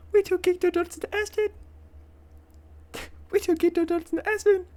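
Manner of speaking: high-pitched mock rap line, half laughing; mimicking the rapper. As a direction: squeak out the lyric like the rapper.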